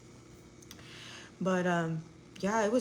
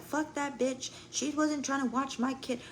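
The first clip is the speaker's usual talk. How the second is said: higher mock of the angry mom; imitating the other mom, higher and indignant; 6 semitones higher than usual.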